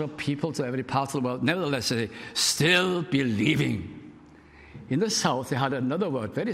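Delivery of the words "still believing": slow, savoured. Acted. Repeating the revival greeting.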